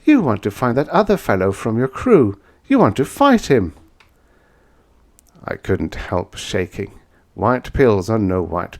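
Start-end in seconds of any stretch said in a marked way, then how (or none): 0.0-3.7 woman's voice, higher, pressing on the word fight; lightly played woman who sees through him; knowing woman.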